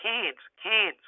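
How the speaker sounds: "Cairns" said nasally through a pinched nose, like "cans"; pinching nose. Shown as a demonstration. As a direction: hold your nose and say "Cairns, Cairns". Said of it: "hold your nose and go".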